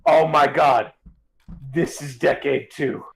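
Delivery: ordinary delivery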